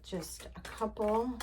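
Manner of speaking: slow, breathy hush; slow whisper. Real, not acted. Settling in to pull a couple of cards, slow whisper. Before starting the card reading.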